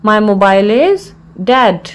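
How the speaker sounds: measured, with a clear mid-phrase pause; broken into parts. Shown as a demonstration. Teacher repeats the phrase for learners, in separated parts.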